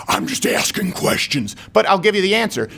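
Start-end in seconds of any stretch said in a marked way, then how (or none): 0.1-1.5 whispered, slow fake innocence; mock innocent. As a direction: feign innocence in a hush.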